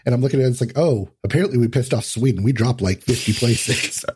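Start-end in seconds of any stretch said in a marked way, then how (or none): none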